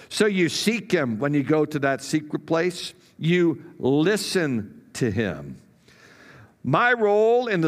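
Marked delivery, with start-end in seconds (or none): none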